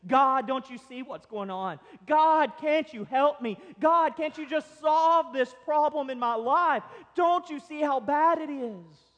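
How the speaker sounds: high, forceful pleading; loud crying out. Acted. Believer in trouble begging God for help, loud cry. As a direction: cry out to heaven in desperation. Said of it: "cry out to God with a loud voice".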